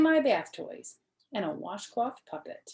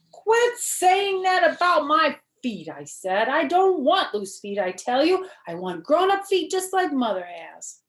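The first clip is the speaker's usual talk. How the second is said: put-on high, loud, insistent little girl protesting; a kindergartner stamping her foot in words; small girl loudly insisting to her mother; 8 semitones higher, 8 dB louder than usual. Junie B argues with Daddy.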